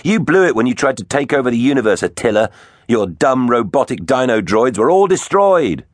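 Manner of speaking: high, quick taunt; feigned cockiness. A narrator's reading. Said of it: "with a cockiness he didn't feel".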